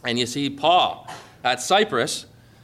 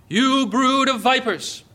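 stern rebuke; 7 semitones higher, slower than usual. Acted. Preacher voicing John's rebuke.

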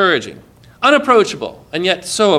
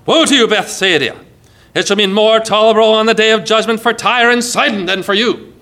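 raised, thunderous pronouncement of judgment; thundering; 4 semitones higher, 3 dB louder than usual. Acted. Jesus pronouncing woe on unbelieving towns, thundering. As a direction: thunder it out as a warning. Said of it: "Imagine the thunder of that word".

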